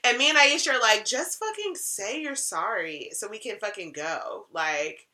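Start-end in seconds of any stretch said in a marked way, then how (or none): 1.1-5.1 softer, hissed-back urgent plea; hushed urging. Retold or imitated. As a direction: urge a friend under your breath to apologise. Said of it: "silently jumping and screaming in the kitchen".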